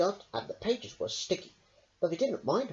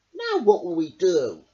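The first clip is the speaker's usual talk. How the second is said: huffing; 3 semitones higher, 9 dB louder than usual. Acted. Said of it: "huffed Fox".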